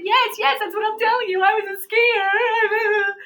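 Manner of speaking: very high, loud mock wail; hammed-up crying plea at the top of the voice; retold tearful outburst. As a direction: wail it out, drawing out the last word. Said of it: "all of a sudden I burst into tears".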